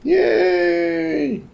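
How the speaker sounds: very high squeal of joy; excited cheer. Real, not acted. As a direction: squeal like at a party. Cheering podcast turning legal.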